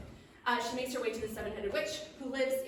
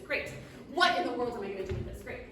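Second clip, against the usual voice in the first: ordinary talk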